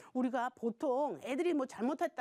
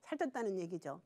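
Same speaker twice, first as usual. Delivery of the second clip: ordinary delivery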